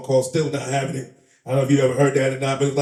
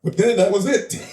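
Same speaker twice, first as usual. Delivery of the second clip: ordinary delivery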